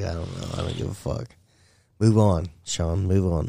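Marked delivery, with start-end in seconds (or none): 0.0-1.3 quiet couldn't-care-less mumble; indifferent mumble. Retold or imitated. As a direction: shrug it off under your breath. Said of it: "He's like".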